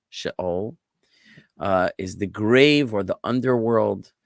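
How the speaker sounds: ordinary talk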